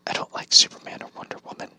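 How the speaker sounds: soft unvoiced whisper, quick; whispered aside. Real, not acted. Vlogger confesses to viewers he dislikes Superman, whispering.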